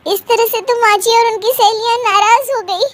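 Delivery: ordinary delivery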